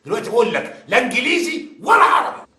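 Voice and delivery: high, loud furious shout. Acted. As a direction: shout the order at him. Investigator yells at suspect.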